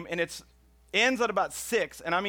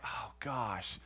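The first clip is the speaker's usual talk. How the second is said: muttered dismay; 8 semitones lower, 12 dB quieter than usual. Retold or imitated. Finding the truck still blocked.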